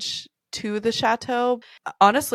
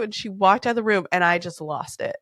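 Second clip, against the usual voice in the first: ordinary talk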